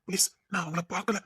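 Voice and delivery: usual speaking voice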